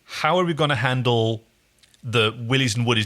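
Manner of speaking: ordinary delivery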